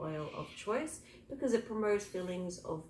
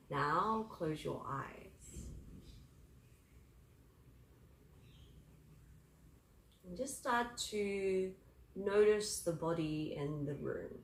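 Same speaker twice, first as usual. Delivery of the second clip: guided meditation; 6 dB quieter than usual. Acted.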